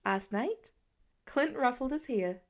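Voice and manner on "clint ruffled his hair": ordinary delivery, nothing marked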